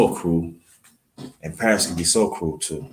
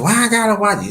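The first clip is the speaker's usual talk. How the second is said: higher, pushed-up protest; mock outraged little brother; indignant kid brother; 12 semitones higher, 4 dB louder, faster than usual. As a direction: snap back like a defiant teen. Brother talks back to mom.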